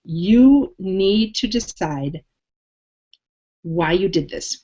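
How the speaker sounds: ordinary talk, nothing marked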